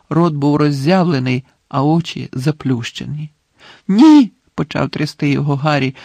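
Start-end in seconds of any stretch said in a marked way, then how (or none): 3.9-4.3 high, loud cry of denial; horrified shout. A narrator's reading. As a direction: shout in panic at a collapsed man. Harry shakes collapsed Dumbledore, crying out.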